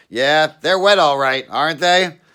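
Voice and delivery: high, loud put-on cop voice; a suspicious cop barking a question; gruff cop. Cop questioning a wet man in bushes, loud high voice.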